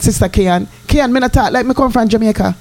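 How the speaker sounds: normal talking voice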